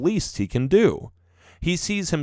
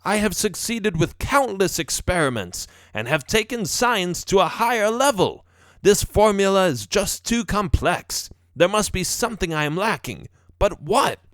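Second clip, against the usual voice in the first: higher-pitched professor wrestling with a problem; frustrated professor; 8 semitones higher than usual. A narrator's reading. Professor musing on his failed experiments, frustrated. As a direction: argue it out with yourself, pitched up.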